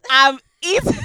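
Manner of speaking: loud high yell into the mic; shouting. Real, not acted. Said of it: "Even louder".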